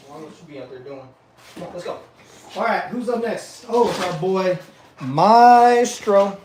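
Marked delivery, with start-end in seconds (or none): none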